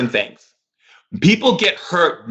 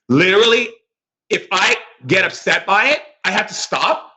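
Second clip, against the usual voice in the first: normal talking voice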